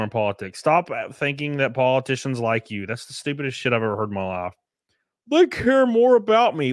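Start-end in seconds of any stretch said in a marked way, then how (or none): 5.3-6.7 high, loud childish mockery; sneering impression of a naive voter; mocking naive voice. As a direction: parrot a gullible twelve-year-old. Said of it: "What are you, fucking twelve?".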